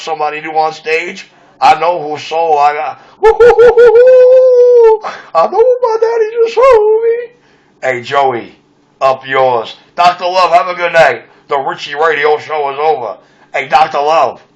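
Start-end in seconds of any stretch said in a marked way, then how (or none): 3.2-5.0 long falsetto whoop of joy; high whoop. Real, not acted. Whooping to his girlfriend in high falsetto. Ecstatic whoop about dancing. 5.3-7.3 high, loud, overcome voice; ecstatic high cry. Real, not acted. Telling his girlfriend about his daddy, high and overcome. 10.0-11.3 radio sign-off. Acted. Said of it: "radio sign-off catchphrase".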